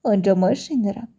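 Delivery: usual speaking voice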